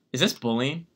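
ordinary delivery

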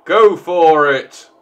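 slow, loud cheer. Real, not acted. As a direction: cheer him on. Player cheering on companion.

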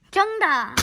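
loud, high "correct!"; excited correct. Real, not acted. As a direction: cheer the right answer. Kid answered correctly.

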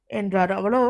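ordinary delivery, nothing marked

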